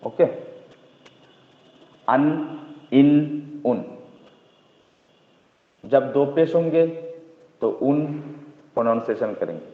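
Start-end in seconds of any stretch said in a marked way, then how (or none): 2.1-4.0 tanween endings one by one with pauses; "an", "in", "un", each set apart; "an, in, un" pronounced separately. Shown as a demonstration. Teacher recaps the three tanween sounds, "an, in, un" pronounced separately. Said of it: "इसका pronunciation होगा".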